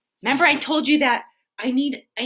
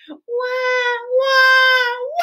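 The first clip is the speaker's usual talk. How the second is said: put-on high, loud toddler bawl; the try she keeps as the right cry; proper mock wail; 13 semitones higher, 8 dB louder, much slower than usual. Shown as a demonstration. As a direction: give it the full fake cry. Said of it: "We don't like that one".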